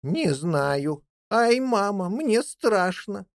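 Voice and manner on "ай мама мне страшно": higher, drawn-out little whimper of fear; frightened child. A narrator's reading. Young jackdaw telling mother of its fear, frightened. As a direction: plead to mother, a touch scared. Chick frightened by approaching visitors.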